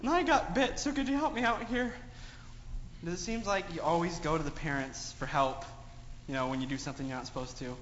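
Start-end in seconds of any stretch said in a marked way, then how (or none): 0.0-1.9 high, whiny plea to Mom with a sigh; pleading kid voice. Acted. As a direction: beg Mom for help like a guilty child. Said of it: "and they're like".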